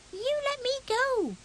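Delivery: high squeaky protest; squeaked. Acted. Fuzzy Peg carried off by a stranger, high and squeaky. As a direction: squeak it out, tiny and scared. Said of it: "he squeaked".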